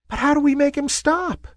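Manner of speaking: high, quick squeak; squeaky little cloud. A narrator's reading. Wispy cloud asking the others for help, squeaky. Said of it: "squeaked a wispy little cloud".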